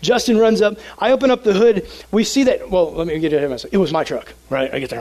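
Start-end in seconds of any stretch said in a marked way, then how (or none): none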